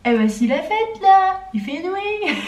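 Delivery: high, slow sing-out; playful outburst. Pretend. Vlogger clowning for viewers at night, high playful voice.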